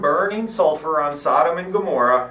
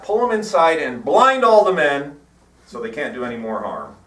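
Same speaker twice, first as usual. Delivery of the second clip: normal talking voice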